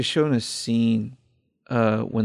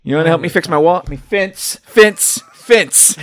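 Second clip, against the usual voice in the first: flustered self-correction; 5 semitones higher, 6 dB louder than usual. Acted. Plays actor flubbing a wall line.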